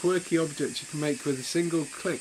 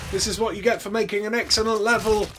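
higher, louder mock taunt; 5 semitones higher, 5 dB louder than usual. Real, not acted. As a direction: shout at the boss playfully. Taunting boss in fight.

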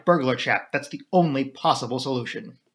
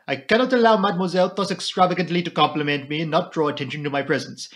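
put-on Poirot in a lightly French-accented voice; courteous Belgian detective, French accent; 3 semitones higher, 3 dB louder than usual. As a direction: gallant French-accented apology.

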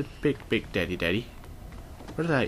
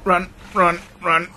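1 urgent run; 8 semitones higher, 7 dB louder than usual. Real, not acted. Fleeing in game.

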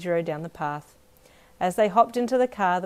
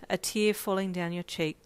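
plain reading voice

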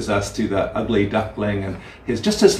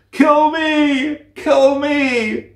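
much higher, louder despairing cry of the little duckling; desperate duckling plea; 4 dB louder, much slower than usual. Acted. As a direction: beg for death like the poor little ugly duckling. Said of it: "said the poor little ugly duckling".

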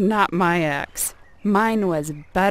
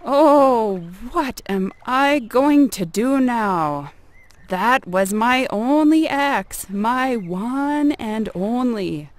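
loud, high, drawn-out wail put on for show; sad shout, pretended; 5 semitones higher, 7 dB louder, slower than usual. A narrator's reading. Greedy neighbor faking loss to summon the goddess, mock-sad shouting. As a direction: cry out slowly in feigned sorrow. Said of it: "pretended to look sad and shouted".

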